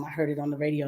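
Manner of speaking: ordinary delivery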